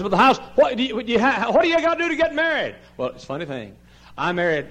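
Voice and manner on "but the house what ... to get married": high, loud flustered question; mock questioner. Preacher voicing someone asking how to get married, high. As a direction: tumble out a nervous question. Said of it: "And somebody said".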